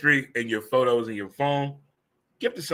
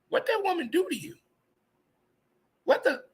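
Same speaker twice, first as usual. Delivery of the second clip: high, incredulous squeak, pitched way up; mock disbelief; 3 dB quieter than usual. Host teasing a man crying on his couch, squeaky disbelief. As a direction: gasp it out like you can't believe the tears.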